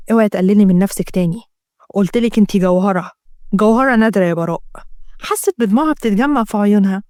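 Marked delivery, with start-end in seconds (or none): 0.1-4.6 lower-pitched, steady male voice; reassuring man. Acted. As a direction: reassure her in a man's low register. (صوت رجل يطمئنها، منخفض الطبقة)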